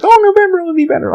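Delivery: very high, sing-song mock optimism; mocking quote. Mocking people who say things will improve. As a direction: parody what everybody keeps saying. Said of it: "everybody's like".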